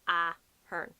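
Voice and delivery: AH-hern, punched A then falling; stress at start. Shown as a demonstration. Demonstrating emphasis at the start, Ahern falling away. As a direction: lean on the A of Ahern, then drop. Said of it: "at the start".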